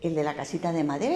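usual speaking voice

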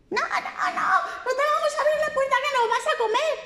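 very high piggy question; high piglets asking who's there; squeaky piglets. A narrator's reading. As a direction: squeak it like tiny pigs. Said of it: "qué le dijeron".

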